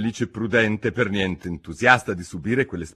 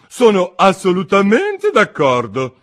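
higher, louder, pompous duchess; 12 semitones higher, 11 dB louder, slower than usual. A narrator's reading. Duchess lectures Alice, pompous and higher. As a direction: preach a moral she cannot recall.

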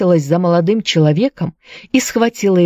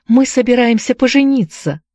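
raised, high-pitched exclamation; exclaiming; 7 semitones higher than usual. A narrator's reading. Anne tells the tavern keeper of the betrothal, exclaiming. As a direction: exclaim it eagerly. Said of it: "воскликнула Энн".

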